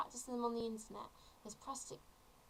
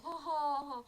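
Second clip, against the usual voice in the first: higher, louder put-on laugh; mocking fake laugh; 4 semitones higher, 6 dB louder, slower than usual. Imitating a viewer who finds joke messages funny, mock laughing. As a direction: imitate a prankster's spelt-out "ha ha ha". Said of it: "you're just watching it and you think".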